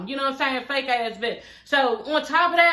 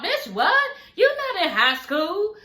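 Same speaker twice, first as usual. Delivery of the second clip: incredulous laugh; 6 semitones higher, slower than usual. Real, not acted. Reacting in disbelief to Melanie's quote.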